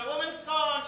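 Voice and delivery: ordinary talk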